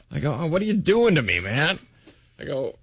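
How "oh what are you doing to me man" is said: high-pitched, exasperated mock plea; pleading protest. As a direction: protest at being put on the spot. Retold protest at accent request.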